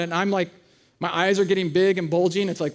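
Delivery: ordinary talk, nothing marked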